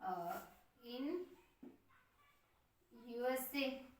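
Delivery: each word spaced out slowly for writing down; slow dictation. Shown as a demonstration. Teacher dictating a note to students, slow and spaced. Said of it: "लिख सकते हैं".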